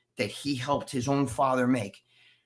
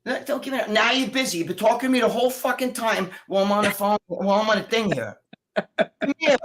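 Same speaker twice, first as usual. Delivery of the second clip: higher-pitched, impatient complaint; exasperated parental scolding; 5 semitones higher than usual. Real, not acted. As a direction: scold her, then summon her over. Real scolding of busy daughter.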